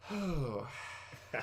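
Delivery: long audible exhale; demonstrated deep breath. Shown as a demonstration. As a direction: breathe out slowly in relief. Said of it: "let's breathe with me right now".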